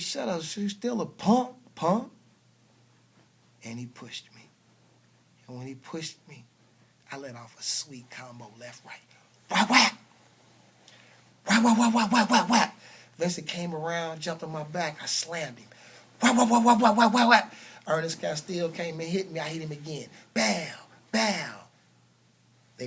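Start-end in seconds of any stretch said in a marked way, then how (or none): 0.0-2.1 a loud, higher-pitched sneering taunt; mock the kid like a schoolyard bully. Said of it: "He turns around and says". 9.5-12.8 loud, higher mock punch noises; punch sound effects. Imitating combo of punches. 16.2-17.5 loud, higher mock punch noises; punch sound effects. Imitating punches on Vincent. 20.3-21.7 a loud string of mock punch noises; punch sound effects. Imitating punches.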